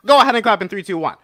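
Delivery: loud, high count to three; loud countdown. Real, not acted. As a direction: call the clap cue out loud. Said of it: "counting down a clap".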